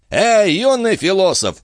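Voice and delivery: high, forceful hailing shout; bellowing. A narrator's reading. Jean bellows at Gilbert sitting in the carriage, bellowing. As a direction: hail him at full voice. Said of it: "во всю силу лёгких рявкнул Жан".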